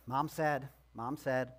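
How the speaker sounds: low, sighing compliance; grudgingly obey, with a sigh; mock-weary and resigned. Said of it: "so maybe with a sigh".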